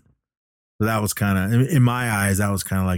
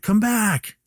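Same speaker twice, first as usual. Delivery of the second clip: very high, drawn call, not shouted; retold pleading call; 14 semitones higher than usual. Billy to departing Jake, mock pleading call. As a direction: imitate begging someone to come back.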